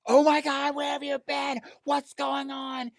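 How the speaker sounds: high, loud frantic woman, slow at the end; panicked Becky. Retold or imitated. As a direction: shriek it like a panicked girlfriend. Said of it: "And she goes".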